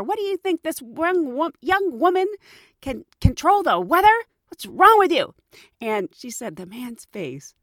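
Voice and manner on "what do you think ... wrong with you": higher, rising to a loud outraged scolding; indignant rant. Retold or imitated. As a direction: scold the complainer, building to a shout.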